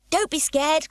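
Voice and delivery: high teasing call; a light mock-soothing cry; cried out. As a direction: call out to the wobbling policemen. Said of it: "cried Pippi".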